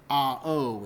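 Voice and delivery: AH, OH as two distinct sounds; two vowels kept apart; separate vowels. Shown as a demonstration. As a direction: split it into "ah, oh". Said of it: "sorry, A O is not".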